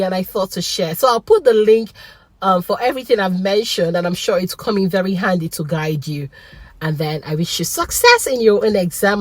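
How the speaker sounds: usual speaking voice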